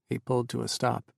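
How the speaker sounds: ordinary delivery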